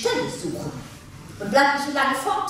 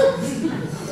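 mocking laugh; 11 semitones higher than usual. Wife laughs mockingly at him.

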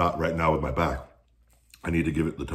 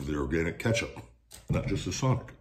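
talking between bites; chewing while talking; 2 semitones higher than usual. Real, not acted. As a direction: mouth partly full. Seen 2: Fingers pick food near mouth; jaw works between words throughout.

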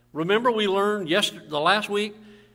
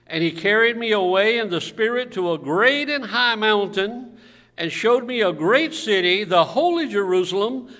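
loud Bible reading, voice lifted; preacher declaiming scripture to the room; scripture reading aloud; 4 semitones higher, 8 dB louder than usual. Real, not acted. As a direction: proclaim the verse with force. Reads scripture to congregation.

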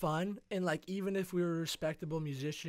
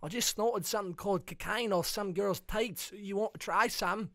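higher, louder hammed-up Australian; Australian accent; 5 semitones higher, 6 dB louder than usual. Bandmate offering Joey drugs in bad Australian accent. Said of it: "I don't even know how to do Australian".

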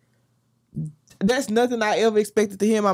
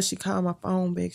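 usual speaking voice

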